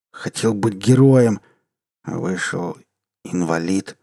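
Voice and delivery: put-on slow, wailing drunk lament; drunk self-pity. Drunk self-pitying rant.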